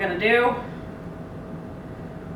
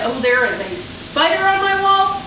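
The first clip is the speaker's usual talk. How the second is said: higher-pitched alarm at a spider; startled; 6 semitones higher than usual. Real, not acted. Cook suddenly spots a spider on the wall, startled. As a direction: blurt it out, voice jumping up.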